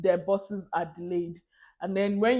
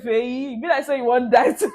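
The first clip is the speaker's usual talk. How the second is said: a touch of louder, fast Pidgin self-scolding; scolding herself; 4 semitones higher, 6 dB louder than usual. Retold or imitated. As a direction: warn yourself in Pidgin, quick. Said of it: "I said".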